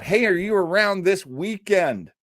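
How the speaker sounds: normal talking voice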